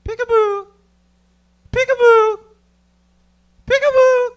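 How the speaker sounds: high loud playful peekaboo; stoned peekaboo. Pretend. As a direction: sing it out like a baby game. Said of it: "Start playing peekaboo with myself".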